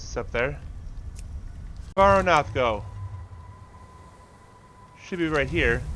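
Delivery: ordinary delivery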